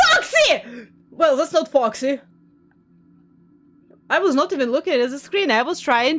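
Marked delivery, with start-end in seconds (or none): 0.0-0.6 startled, blurt the name out high; startled gasp and cry. Real, not acted. Sudden jump scare.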